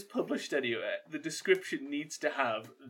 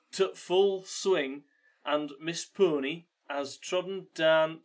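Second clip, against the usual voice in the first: put-on halting, slow read in a broad Yorkshire accent; attempted Geordie gone Yorkshire; slower than usual. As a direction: try Geordie, slide into Yorkshire, pausing between phrases. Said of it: "It's gone Yorkshire".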